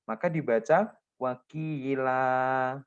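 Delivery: wakila said slowly, ending cut on open a; pausal reading. Shown as a demonstration. Teacher shows how to stop on a word, slow. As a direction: model wakila as when stopping. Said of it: "ini kalau dia berhenti wakof".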